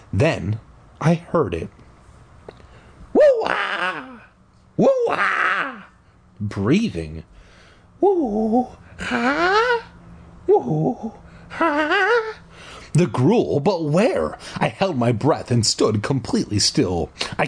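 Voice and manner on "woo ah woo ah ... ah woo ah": high, long whooping breaths; creature breathing sound. Retold or imitated. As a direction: howl the breaths like a ghost.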